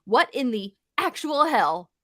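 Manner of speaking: slow, heavy emphasis on "actual hell"; all-caps emphasis. Retold or imitated. Reading an angry tweet aloud, all-caps emphasis on "actual hell". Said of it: "Also all caps".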